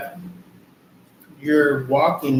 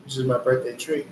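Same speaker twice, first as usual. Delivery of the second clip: ordinary delivery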